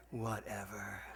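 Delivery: low, soft, bored donkey; lazy donkey voice. Retold or imitated. Tired donkey giving up on the climb, low bored voice. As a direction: sigh it out like a donkey that gave up.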